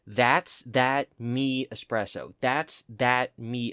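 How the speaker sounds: drawn-out slow recitation; slow repeated lyric. Shown as a demonstration. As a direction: puzzle over each word slowly. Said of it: "saying them over and over".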